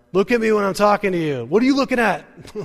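loud, high-pitched angry scolding; scolding parent. Acted. Parent demanding a child's attention, angry and loud. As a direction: tear into a kid like a fed-up parent. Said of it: "you know, the parents, they're like".

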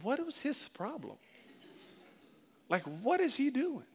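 hushed, baffled mutter to a guest; mock bewildered whisper about the guest; bewildered and hushed, trying not to be overheard; puzzled host. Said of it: "You're gonna be like".

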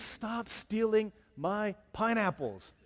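loud, slow, high outburst of exasperation; hammed-up irate missionary, each word drawn out; angry missionary. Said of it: "imagine this angry missionary".

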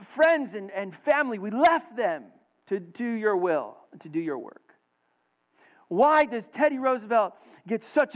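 high-pitched, insistent acted plea; pleading. Missionary pleading with the Lord at night, aggrieved pleading. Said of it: "he pleads to the Lord".

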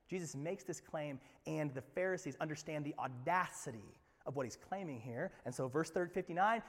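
ordinary talk

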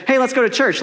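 eager invitation. Acted. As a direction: pitch it up as if inviting friends along. Acts out inviting new friends along.

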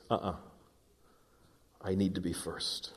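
low, hushed, firm voice of God; God's quiet correction. Acted. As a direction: drop low and soft, gently refusing. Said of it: "Yet God says".

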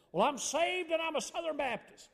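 high, loud, over-eager mimicry; out-there Southern Baptist. Man loudly declares his faith to seatmate, hammed-up out-there voice. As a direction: belt it out as a hammed-up zealous Baptist. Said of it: "he was real out there".

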